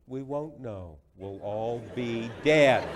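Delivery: loud, slow build to a booming DEAD; punchline delivery. Retold or imitated. Comic punchline quoting Bush back.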